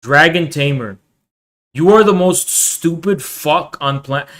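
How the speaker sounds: ordinary talk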